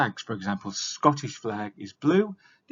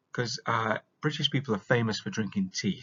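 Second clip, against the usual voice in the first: usual speaking voice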